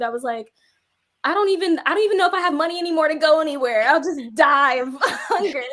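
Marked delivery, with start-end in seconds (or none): 1.2-5.7 high, fast, half-laughing rant; laughing disbelief. Retold or imitated. Telling partner she can't afford food, laughing high and fast. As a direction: joke about starving through the giggles. Retelling her joke after being fired.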